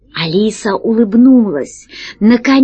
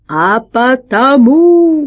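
slow, heavy, louder threat; menacing; 4 semitones higher, 4 dB louder, much slower than usual. Acted. Little catastrophist answering the pirates, slow menacing reply. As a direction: lay the words down darkly. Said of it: "грозно ответил".

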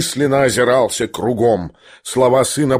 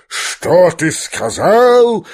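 high, drawn-out outraged question; thundering; 6 semitones higher, 3 dB louder, much slower than usual. A narrator's reading. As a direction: thunder it slowly in disbelief. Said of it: "загремел генерал". (грозно, протяжно, возмущённо)

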